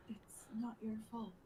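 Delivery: soft, breath-light reassurance; gentle comfort. Acted. Consoling a companion who blames himself, gently hushed. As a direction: say it quietly to soothe.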